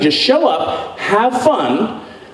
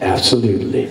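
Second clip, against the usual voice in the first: low absolutely; 14 semitones lower, faster than usual. Retold or imitated. Low reply.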